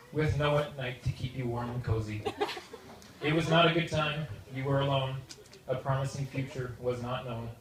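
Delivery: light rhyming verse read aloud; wedding toast poem. Acted. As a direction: recite a rhyming poem with comic timing. Reads his rhyming wedding poem.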